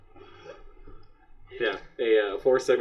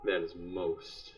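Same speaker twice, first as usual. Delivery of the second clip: quiet, measured menace in a slightly lowered voice; ominous clergyman; 5 semitones lower, 11 dB quieter than usual. Acted. GM acts ominous clergyman cornering eavesdropper.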